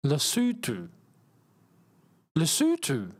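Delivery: "Lesotho" as luh-SOO-too; actual pronunciation. Shown as a demonstration. Streamer learning the real name, careful "Lesotho", luh-SOO-too. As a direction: practise "Lesotho" with two OO vowels, falling. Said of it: "How do you actually say Lesotho?".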